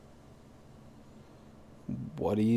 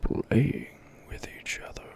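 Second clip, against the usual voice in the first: whispering; 3 semitones higher than usual. Shown as a demonstration. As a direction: whisper the repeated words. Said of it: "repeats line in whisper".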